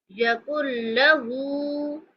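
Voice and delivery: यकुल लहू with the ल doubled, no न heard; idgham. Shown as a demonstration. Teacher demonstrating merged ल in यकुल लहू. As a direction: merge into यकुल्लहू, slowly. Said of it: "मिलाकर पढ़ेंगे".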